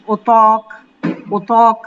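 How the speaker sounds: ওতক with the alif drawn out after the t; long open vowel after t. Shown as a demonstration. Teacher explaining the alif to students, careful pronunciation. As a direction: stretch the second syllable of ওতক into a long open aw. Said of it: "এই জন্য আমি ত বলেছি".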